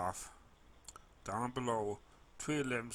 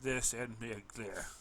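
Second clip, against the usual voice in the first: ordinary delivery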